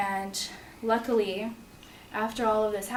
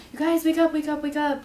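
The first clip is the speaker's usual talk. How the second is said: higher, louder, urging sleepers awake; urgent wake-up call; 6 semitones higher, 4 dB louder than usual. Retold or imitated. Aunt waking the siblings at the hospital bed, urgent call. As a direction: call them awake, it's almost time. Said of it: "my aunt saying".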